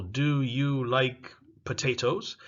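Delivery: "Do you like potatoes?" said slowly and clearly; slow example sentence. Shown as a demonstration. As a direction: spell out the model question slowly. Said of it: "for example".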